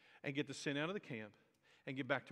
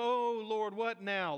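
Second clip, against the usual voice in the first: mock whiny lament, higher and louder; 5 semitones higher, 5 dB louder, slower than usual. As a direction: wail it like a put-upon complainer. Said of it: "it sounds whiny".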